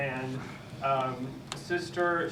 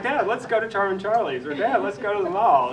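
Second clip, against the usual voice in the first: higher, louder mock-eager teen plea; retold eager daughter; 5 semitones higher, 6 dB louder than usual. Daughter asks Dad to go shopping, retold eagerly. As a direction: lightly imitate a daughter pitching a shopping trip.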